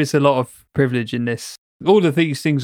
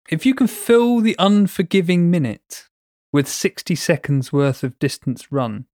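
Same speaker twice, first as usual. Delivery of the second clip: slow, measured poem reading, starting higher; poem recitation; 4 semitones higher, slower than usual. Acted. Reading the poem to co-host, slow recitation. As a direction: read the verse out slowly and deliberately.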